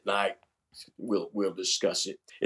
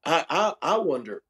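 usual speaking voice

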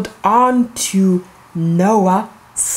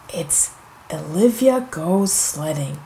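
hushed title reveal; 6 semitones lower, 6 dB quieter than usual. Acted.

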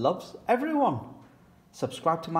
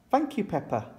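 gentle teacher voice; 7 semitones higher than usual. Acted. Teacher character voice.

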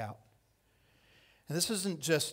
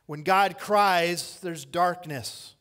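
slow, louder "When God cries" with weight on "God"; slow emphatic; 3 semitones higher, 7 dB louder, much slower than usual. Shown as a demonstration. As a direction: lean on every word of "When God cries". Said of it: "slow emphatic preaching".

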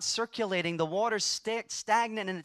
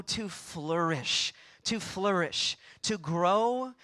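ordinary talk, nothing marked

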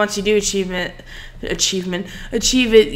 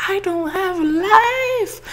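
Syndicate impression; 11 semitones higher, slower than usual. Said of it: "my Syndicate impersonation voice".

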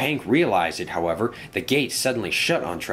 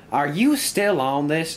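higher, drawn-out annoyed question; a touch of fed-up disbelief; mild exasperation; 7 semitones higher, 3 dB louder, slower than usual. Acted. Engine asking the two worriers, mildly exasperated.

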